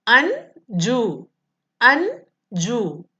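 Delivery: Anju said slowly as two clear beats; Anju drawn out, syllables apart. Shown as a demonstration. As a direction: spell out Anju by its syllables for a class. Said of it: "വേർഡിനെ നമ്മൾ different syllable ആയിട്ട്".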